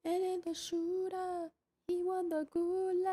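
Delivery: high hyped announcer call. Acted. Announces the gulag winner.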